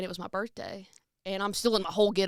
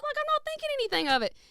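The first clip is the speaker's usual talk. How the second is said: much higher and faster; squeaky high repeat; faster than usual. Acted. Singer repeating her carefree thought in a squeaky voice. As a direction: say it in a squeaky mock-innocent voice.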